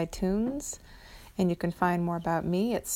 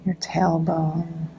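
guided meditation; 4 dB louder than usual. Acted.

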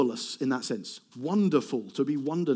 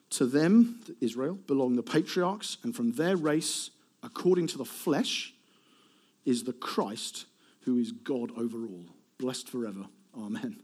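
scripture reading; 4 semitones lower than usual. Acted. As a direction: read it solemnly, settling down to a hushed amen. Reads Romans 9:5 aloud.

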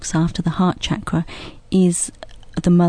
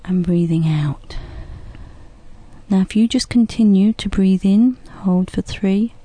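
guided breathing; 3 dB quieter than usual. Shown as a demonstration. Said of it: "So you can just follow along with us".

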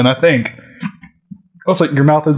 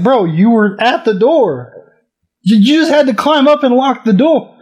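higher, incredulous bro complaint; higher voice, mock exasperation at the hero; exasperated quote; 9 semitones higher than usual. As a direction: plead with the screen in disbelief. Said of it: "I was like".

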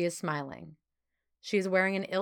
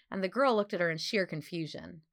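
ordinary delivery, nothing marked

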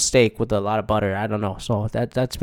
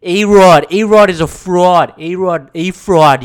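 higher, louder brash fan rant; hammed-up loudmouth fan imitation; New York sports fan; 4 semitones higher, 7 dB louder than usual. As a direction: belt it like a mock sports radio caller. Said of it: "New York sports fan that was like".

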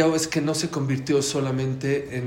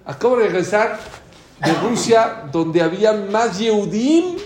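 impassioned rabbi's warning; imitated fiery sermon; 6 semitones higher, 7 dB louder than usual. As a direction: thunder a warning to the crowd.

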